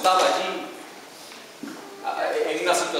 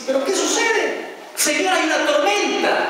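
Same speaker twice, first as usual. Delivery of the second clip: surprised question; 6 semitones higher than usual. Retold or imitated. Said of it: "Jesús se levanta y dice".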